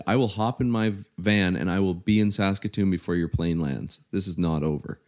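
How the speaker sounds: ordinary delivery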